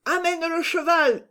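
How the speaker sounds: high, strained yell; hollered command. A narrator's reading. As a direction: bellow the order out the window high up. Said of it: "hurla Planchet".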